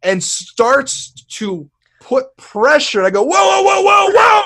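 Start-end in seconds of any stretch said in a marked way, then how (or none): 3.2-4.4 frantic high-pitched protest in pain; panicked yelling. Retold or imitated. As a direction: yell out in pain and alarm at the doctor.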